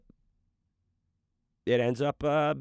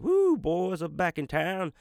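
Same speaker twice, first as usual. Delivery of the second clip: high, slow sung-out celebration; gleeful cheer; 9 semitones higher, 4 dB louder, slower than usual. Pretend. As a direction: crow it like a party anthem. Wife away, celebrating.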